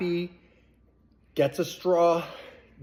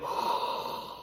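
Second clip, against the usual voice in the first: awed gasp; 8 dB quieter than usual. Retold or imitated. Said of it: "She's like".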